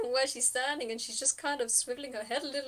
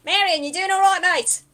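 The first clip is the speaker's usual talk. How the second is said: much louder, higher call across the woods; yelling out; 7 semitones higher, 13 dB louder than usual. Acted. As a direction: shout to your mate from afar. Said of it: "they're gonna yell out".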